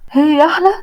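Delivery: jealous wife echoes his insult, incredulous; jealous disbelief. Acted. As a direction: throw his words back in disbelief. Jealous wife hears she is prettier.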